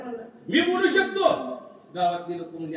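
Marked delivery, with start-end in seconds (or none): 0.5-1.3 boastful retort. Retold or imitated.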